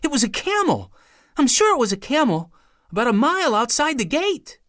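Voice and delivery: put-on high, eager child's voice; a boy insisting on what he saw; excited young boy. A narrator's reading. Douglas telling his father.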